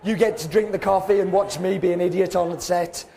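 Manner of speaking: ordinary delivery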